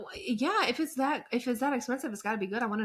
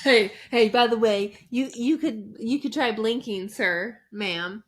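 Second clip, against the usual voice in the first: loud, pushy street call to a passer-by; stranger calling out; 6 dB louder than usual. Retold or imitated. A stranger on the street stops someone, loud call. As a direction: hail them like a random stranger on the street. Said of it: "someone on the street comes up to you and says that".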